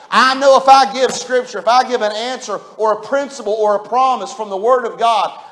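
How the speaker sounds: high, loud pulpit preaching; full-force preaching. Acted. Preacher warning his congregation, loud fervent preaching. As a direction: preach it out with fire.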